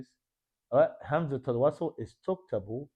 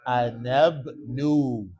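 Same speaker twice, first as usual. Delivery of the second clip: slowed out; 6 dB louder, much slower than usual. Shown as a demonstration. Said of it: "Slow it down".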